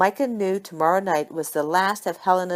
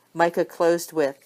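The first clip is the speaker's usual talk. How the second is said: ordinary delivery, nothing marked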